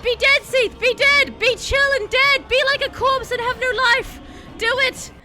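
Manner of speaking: loud, high-pitched frantic yelling; frantic shouting. Real, not acted. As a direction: yell commands desperately at the boss. Pleading for boss to die.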